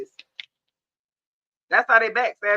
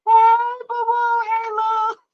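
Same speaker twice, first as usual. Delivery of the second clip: mock greeting; 13 semitones higher, 4 dB louder, much slower than usual. Mocking the ex trying to return.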